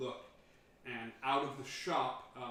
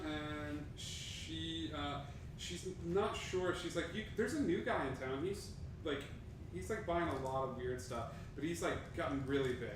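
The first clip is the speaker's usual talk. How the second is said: lightly raised, chatty merchant voice; a chatty local, a bit higher and brighter; higher gossipy shopkeeper; 4 semitones higher than usual. Acted. Shopkeeper gossiping to adventurers about a new guy.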